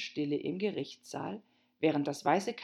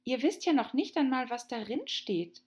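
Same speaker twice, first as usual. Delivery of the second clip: put-on young girl voice; 8 semitones higher than usual. Alice objecting.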